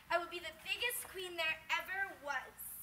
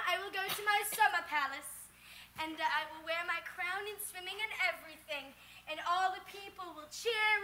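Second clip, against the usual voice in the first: ordinary talk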